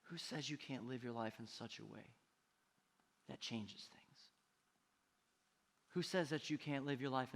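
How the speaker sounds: low, soft, searching question; hushed appeal. Real, not acted. Preacher asking believers how they will live, hushed appeal. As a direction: bring it right down, soft and low.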